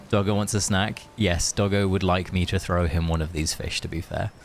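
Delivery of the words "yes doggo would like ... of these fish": ordinary talk, nothing marked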